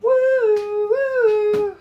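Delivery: high, loud woo woo; excited whoop. Real, not acted. Cook whooping over her cornbread skillet, high and loud. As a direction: whoop with delight. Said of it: "excited about skillet".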